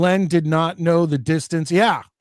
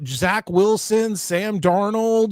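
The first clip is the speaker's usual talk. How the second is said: ordinary delivery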